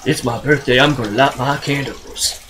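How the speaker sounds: low mock villain voice; film villain one-liner. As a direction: deliver a corny one-liner low, in character. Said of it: "he goes".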